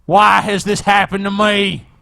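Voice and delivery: hammed-up despairing wail; mock anguish. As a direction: cry out to the heavens. Imagining Galen despairing, wailing why.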